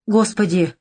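ordinary delivery

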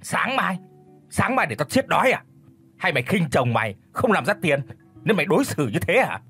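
furious roar. A narrator's reading. Sử roars at his wife about breakfast, enraged. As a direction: rage at her, hurling the question. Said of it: "Sự điên tiết gầm lên".

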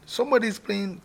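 high sudden shout of alarm. Shown as a demonstration. Drum owner rehearsing, shouting that someone's playing. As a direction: cry out that someone's at the drums.

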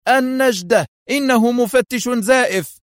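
high, loud alarm cry; screamed for help. A narrator's reading. Adham fakes a struggle for the guards, screaming. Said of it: "ويصرخ".

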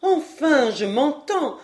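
a touch of offended pride; a bit piqued. Acted. Madame Caudal answering her son, a little piqued. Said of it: "dit madame Caudal un peu piquée".

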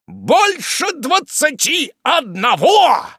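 high, slow, scornful shout; contemptuous exclamation. A narrator's reading. Wardle mocks his sister's claimed age, contemptuous shout. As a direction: stretch it out in loud disbelief and contempt. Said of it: "презрительным тоном".

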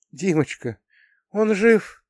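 high, hushed, fearful question; anxious plea. A narrator's reading. Mother looks into her son's eyes, anxious plea. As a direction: ask as if dreading the answer.

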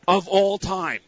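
ordinary delivery

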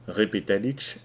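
usual speaking voice

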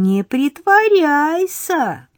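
put-on high, slow wagging-finger girl voice; girl shaking a finger at Egor; scolding little girl. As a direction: scold him like a tiny teacher. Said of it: "Девочка погрозила пальцем".

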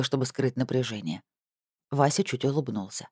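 plain reading voice

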